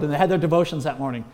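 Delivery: usual speaking voice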